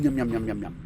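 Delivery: usual speaking voice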